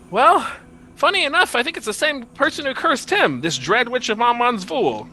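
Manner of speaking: high-pitched prince explaining himself; prince character voice. Acted. Prince telling who cursed him, high earnest voice. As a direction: voice an earnest prince well above your register.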